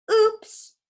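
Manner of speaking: put-on high, loud bratty girl oops; mock oops. A narrator's reading. Nancy dumping lunch on Grover, bratty fake oops. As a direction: squeal it like a mean girl faking an accident.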